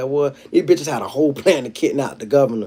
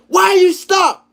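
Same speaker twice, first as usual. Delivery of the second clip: much higher, louder sharp demand; cop yelling; 6 dB louder, slower than usual. Retold or imitated. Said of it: "And then he said".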